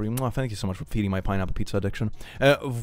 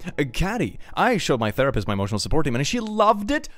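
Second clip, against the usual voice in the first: ordinary delivery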